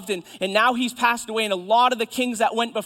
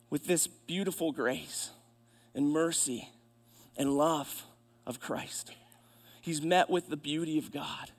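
lower, softer voice, pausing between phrases; hushed reverent preaching; 4 semitones lower, 7 dB quieter than usual. Real, not acted. Preacher describes Isaiah met with grace and mercy, hushed reverence. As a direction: let each word settle gently.

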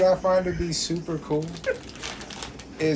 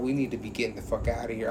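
low, hushed quote, a bit hurried; imitating scary talk; 7 semitones lower, 8 dB quieter, faster than usual. Retelling a billionaire's scary video, imitated in a low hush. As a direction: mimic an ominous warning under your breath. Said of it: "him talking about some scary shit".

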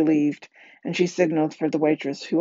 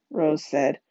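ordinary delivery

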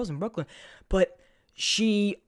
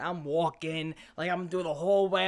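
ordinary delivery

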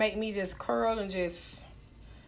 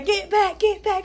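Katt Williams bit; 7 semitones higher than usual. Retold or imitated. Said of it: "what Katt Williams say?".

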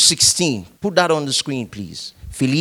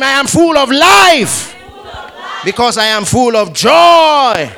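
triumphant shouted confession; shouted declaration; 8 semitones higher, 7 dB louder than usual. Shown as a demonstration. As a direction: shout it out, full voice. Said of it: "Say it one more time".

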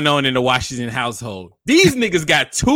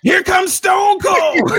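slow, loud, high announcer shout; wrestling commentator; 5 dB louder, much slower than usual. Retold or imitated. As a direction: hype it like a ringside call. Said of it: "did commentary over it and did it like wrestling".

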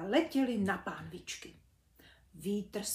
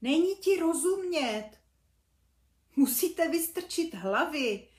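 louder, a bit higher call; mum calling out; 6 semitones higher, 6 dB louder than usual. Acted. Mum calls loudly to the cubs under the duvet. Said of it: "křikla maminka".

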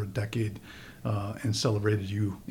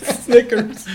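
high laughing one-word answer; giggling through a sultry attempt; 13 semitones higher, 10 dB louder than usual.

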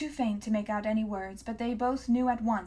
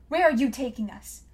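frightened demand; 3 semitones higher, 6 dB louder than usual. A narrator's reading. Captive girl demanding.

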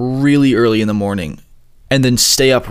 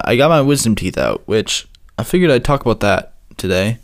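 usual speaking voice

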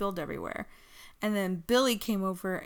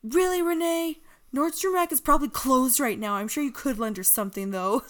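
sarcastic; 5 semitones higher, 6 dB louder than usual. Retold or imitated. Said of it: "Corey Graves was like".

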